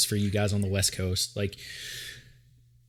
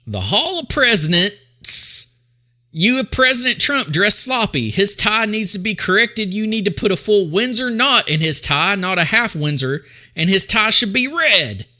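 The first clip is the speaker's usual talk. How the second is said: pompous mock reading; 8 semitones higher, 6 dB louder than usual. Shown as a demonstration. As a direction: read the review like an outraged complainer. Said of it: "Let me read that again".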